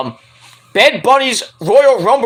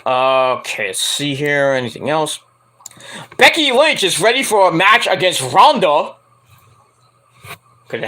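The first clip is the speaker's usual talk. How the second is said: ordinary talk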